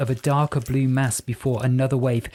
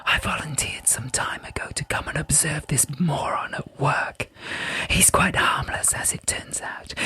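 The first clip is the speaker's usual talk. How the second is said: whispering Pyrope; 3 semitones higher than usual. A narrator's reading. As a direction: breathe each word like a hidden voice.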